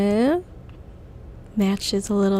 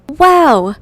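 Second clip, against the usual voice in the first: loud, higher wow; excited shout; 6 semitones higher, 9 dB louder than usual. Real, not acted. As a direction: burst out with delight. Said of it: "I don't know why I said that".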